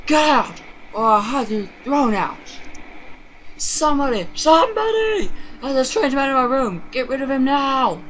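high, loud panicked yelling for help; confused old mother screaming at her son to get out; frightened screaming. Acted. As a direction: scream for someone to come, terrified.

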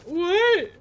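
lightly voiced indignant puppy; mock outraged dog. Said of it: "the puppy looked at me like".

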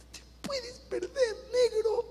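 very high, soft, slow sob; mother sobbing to her son; crying mother. Retold or imitated. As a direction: weep the words out, high and soft. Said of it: "a llorar como una Magdalena".

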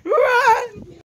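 high, loud yelling at a friend; excited yell. Real, not acted. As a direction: shout for him to run. Yelling during hide and seek.

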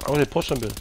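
talking with a mouthful of cookie; words around a bite of cookie; mouth full. Real, not acted. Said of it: "I got a cookie in my mouth".

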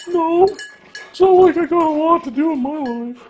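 guilt-trip in a high pup voice; high sulky dog voice. Pretend. Voicing the dog.

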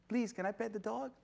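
high, soft, sweet-mannered asking; polite request. Acted. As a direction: ask politely in a small high voice. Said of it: "human being is very polite".

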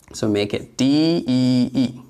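ordinary talk

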